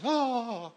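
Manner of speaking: sudden high sung "ah"; mock soprano note; demonstrated high note. Shown as a demonstration. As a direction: let out a high, held "ah". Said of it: "El hombre no".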